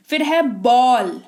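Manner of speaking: normal talking voice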